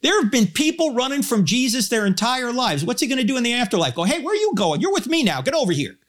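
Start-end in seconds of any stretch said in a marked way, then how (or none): 4.0-6.0 high-pitched, hurried mock order; imagined bossy summons. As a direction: call after someone as if hauling them back. God acted as grabbing a runaway.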